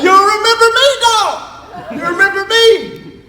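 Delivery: you remember me, dog, pushed high and keen; eager reunion. Retold or imitated. Greeting a high school friend he hasn't seen in years, high and eager.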